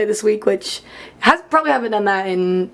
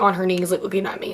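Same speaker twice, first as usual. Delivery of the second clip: ordinary delivery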